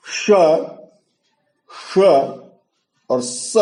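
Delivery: palatal श, retroflex ष-like श, dental स, each apart; three distinct sibilants. Shown as a demonstration. Teacher showing the three sibilants, distinctly articulated श, श, स. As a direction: say श, श and स separately as three different sibilants. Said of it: "तीनों में फर्क नहीं कर पाते".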